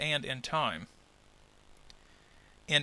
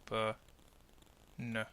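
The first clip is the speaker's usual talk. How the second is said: Ba-na soft and low, each apart; unstressed syllables; 5 semitones lower, 12 dB quieter than usual. Shown as a demonstration. Teacher showing the other two syllables, unstressed. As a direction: drop both Ba-na syllables to short, soft, low sounds. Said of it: "The other two are unstressed".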